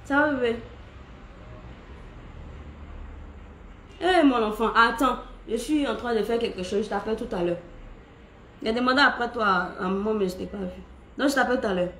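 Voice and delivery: quick soft aside into the phone, busy; quiet phone call. Real, not acted. Takes a phone call from child.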